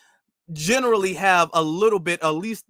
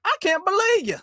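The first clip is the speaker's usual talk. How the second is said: very high, Looney Tunes Southern; mock a put-on Southern accent, voice pitched way up; exaggerated cartoon Southern imitation; cartoonish fake Southern accent; 10 semitones higher than usual. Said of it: "they won't say you. They say".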